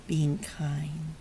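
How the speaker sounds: ordinary talk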